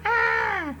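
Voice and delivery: short high imitated kid's yelp; mock yelp. Narrator recalling brother's yelp, mock yelp. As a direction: echo the boy's startled cry.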